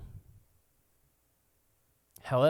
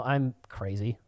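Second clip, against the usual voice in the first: usual speaking voice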